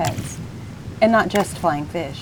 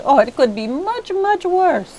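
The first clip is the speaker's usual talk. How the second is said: cheerful; 10 semitones higher, 3 dB louder than usual. A narrator's reading. George cheerful about tuna.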